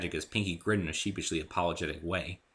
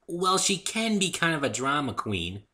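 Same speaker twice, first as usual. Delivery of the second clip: high, slow confiding pony; sheepish Pinkie admission; bubbly secret aside; 9 semitones higher, 4 dB louder, slower than usual. A narrator's reading. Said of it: "Pinkie admitted".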